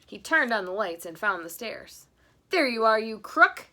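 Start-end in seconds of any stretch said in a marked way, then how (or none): 2.5-3.7 much louder triumphant shout; cried. Acted. Husband catching the supposed burglar, shouting. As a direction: nab the crook. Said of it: "he cried".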